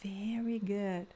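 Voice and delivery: slow, soft teacher praise. Acted. Teacher praising students after each repeat, soft and slow. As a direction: reassure the learner gently.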